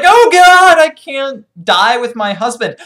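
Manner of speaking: loud, high mock cry of despair; dismayed wail. Captured wife lamenting to herself, mock-dramatic wail.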